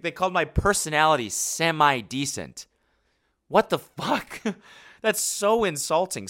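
high, incredulous outrage through laughter; mock-offended. Real, not acted. Reacting to a comment on his personality, laughing indignation. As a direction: act hugely insulted, half laughing.

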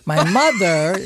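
usual speaking voice

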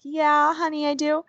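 retold indulgent mom reply; mother humoring child. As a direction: gently play along with a small child. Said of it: "And I'm like".

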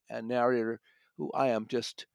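ordinary talk

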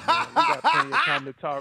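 loud laughing through the words. Real, not acted. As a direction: laugh it out, pitch up high. Laughing loudly mid-sentence.

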